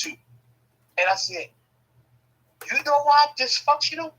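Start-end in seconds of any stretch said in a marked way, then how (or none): 2.7-4.1 mock outrage. Punchline to the uncles.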